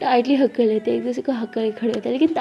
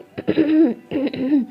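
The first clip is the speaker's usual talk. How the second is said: longer, pointed "ahem"; exaggerated repeated cough; attention-seeking throat clear. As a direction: clear the throat insistently to be noticed. Acted second bid for attention.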